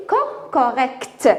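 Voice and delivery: slow "correct" ending in k-t; final T pronounced. Shown as a demonstration. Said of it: "le T se prononce". (correct lentement, T final prononcé)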